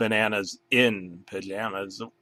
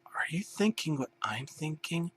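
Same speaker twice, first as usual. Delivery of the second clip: unvoiced, higher scheming whisper; conspiratorial whisper; 6 semitones higher, 11 dB quieter than usual. Banana whispering a scheme to his partner. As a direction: whisper it like a plotting cartoon.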